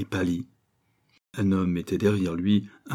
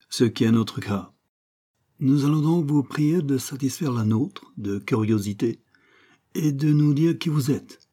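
higher-pitched, louder, mock-polite villain; English gunman; 5 semitones higher, 4 dB louder than usual. A narrator's reading. Gunman questioning his prisoner, mock-polite menace. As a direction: threaten politely as the man with the gun.

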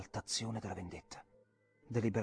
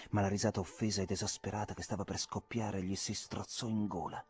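ordinary delivery, nothing marked

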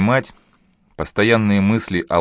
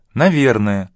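higher, curt, offhand reply; tossed-off retort; 4 semitones higher, 4 dB louder, slower than usual. A narrator's reading. Girlfriend answering her boyfriend's apology, tossing it off curtly. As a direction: toss it back at him, pitched up. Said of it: "бросила Лариса".

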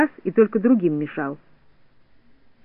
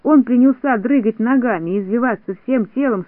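ordinary delivery

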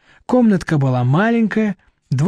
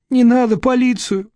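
higher, hoarse plea; 7 semitones higher than usual. A narrator's reading. Vera begging Nadezhda not to call police, hoarse. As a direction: say it with a hoarse throat. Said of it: "хрипло сказала Вера и закашлялась".

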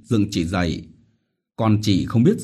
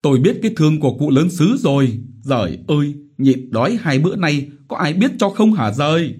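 fast, higher bitter lament; moaning lament; 7 semitones higher, 4 dB louder, faster than usual. Acted. Widow Vít moaning to heaven, bitter lament. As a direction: moan out your grievance. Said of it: "Chị Vít rên rỉ".

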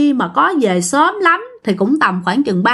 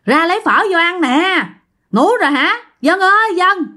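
lightly acted call, pitched up; 6 semitones higher than usual. Friend outside calling sleepers to come eat, calling out. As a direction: call through the door to wake someone. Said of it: "nó đứng ở ngoài nó kêu rằng".

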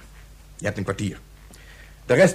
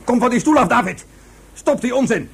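loud, high scolding shout; loud exasperated reprimand; angry scolding; 9 semitones higher, 4 dB louder than usual. Acted. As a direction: scold the boy off the chair. Adult scolds boy for shouting.

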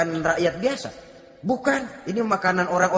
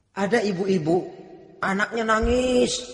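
ordinary talk

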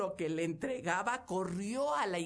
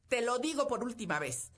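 loud, stern scolding; stern mother; 6 semitones higher, 6 dB louder, faster than usual. Acted. Mother forbidding her daughter the rich suitor, loud stern scolding. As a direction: lay down the law to her daughter.

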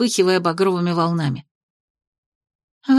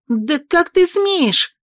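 outraged; 8 semitones higher, much slower than usual. A narrator's reading. Outraged cry after dress torn.

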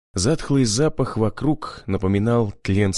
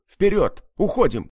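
higher urgent command; shout; 8 semitones higher than usual. A narrator's reading. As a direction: drive them forward. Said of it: "крикнул я".

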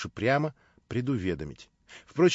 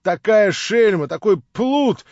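railing; 8 semitones higher, 11 dB louder, slower than usual. Acted. Masloboev rails at prince.

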